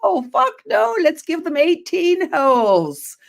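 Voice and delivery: high, slow comic rant; Robin Williams bit. Retold or imitated. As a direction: ham up a stand-up comic. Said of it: "he's like".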